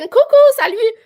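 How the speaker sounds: high, loud singsong hello; bright greeting. Real, not acted. Greeting a lurking viewer in chat, high and loud. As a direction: call out a cheery hi to a shy lurker.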